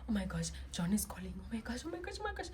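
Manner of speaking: panicked whisper. Retold or imitated. When the boyfriend calls, a panicked whisper. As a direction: imitate frantic whispered panic. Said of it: "I would feel like".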